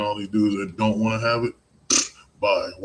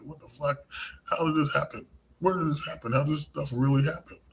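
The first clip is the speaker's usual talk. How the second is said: stunned disbelief; 7 semitones higher than usual. Retold or imitated. Imitating stunned bystander.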